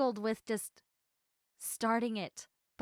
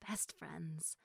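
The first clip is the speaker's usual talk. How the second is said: whisper; 5 semitones lower, 11 dB quieter than usual. Real, not acted. Confiding in the listener about best friends, whispered. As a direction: drop to a whisper on the last words.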